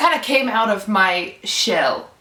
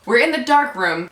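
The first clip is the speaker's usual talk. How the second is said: normal talking voice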